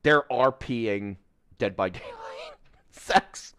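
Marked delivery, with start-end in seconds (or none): 1.6-3.5 half-whispered through a laugh; laughing under the breath; breathy laughing aside. Real, not acted. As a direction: mutter it while laughing. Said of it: "R P Dead by Daylight sex".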